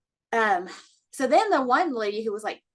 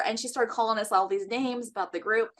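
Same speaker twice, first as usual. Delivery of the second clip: usual speaking voice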